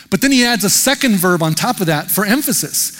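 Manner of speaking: ordinary talk, nothing marked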